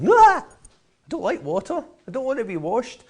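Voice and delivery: squeaky high, slow protest against the water; cartoon molecule squealing that it hates water; fussy molecule voice. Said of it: "the molecules which jump out and go".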